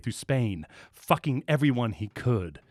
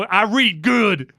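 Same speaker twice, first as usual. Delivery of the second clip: slow dumb boast; 9 semitones higher, 8 dB louder, much slower than usual. Real, not acted.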